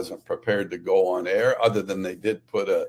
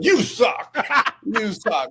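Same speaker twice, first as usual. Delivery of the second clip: mock insult; 11 semitones higher, 4 dB louder, slower than usual. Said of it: "the N F L called me and said".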